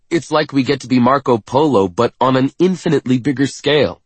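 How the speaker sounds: excited. A narrator's reading. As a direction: dream out loud. Narrator excited about landing.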